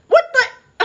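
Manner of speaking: high, loud, laughing what the; floored and laughing; shocked laugh at the absurdity; laughing disbelief. Real, not acted. Reacts to adultery renamed polyamory.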